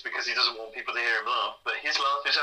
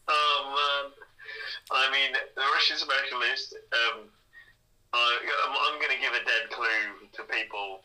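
ordinary talk, nothing marked

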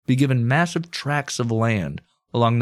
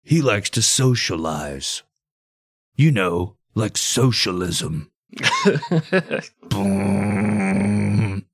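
political attack ad; much slower than usual. Acted. Continuing mock attack ad.

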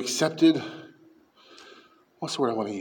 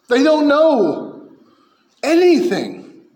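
much higher, louder, slow pulpit cry; preaching at full force; 9 semitones higher, 5 dB louder, slower than usual. Acted. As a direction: thunder it from the pulpit. Preaching climax.

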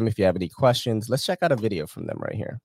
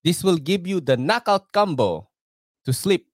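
put-on light Filipino accent, higher pitch; 8 semitones higher, 3 dB louder than usual. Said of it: "That's my Filipino accent there".

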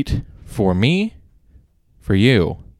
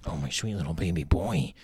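cat voice; 3 semitones lower, 6 dB quieter than usual. Real, not acted. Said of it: "I got my cat voice captured".

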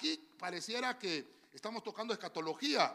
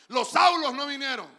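shouted response, louder and higher; 4 semitones higher, 11 dB louder than usual. Shown as a demonstration. Preacher demanding the church say it louder, shouting. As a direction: belt it out so the church joins. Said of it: "No, dígalo fuerte".

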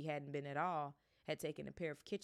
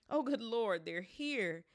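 loud, high frantic cry; 7 semitones higher, 8 dB louder, slower than usual. A narrator's reading. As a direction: panic at the door. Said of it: "said Aunt Petunia frantically".

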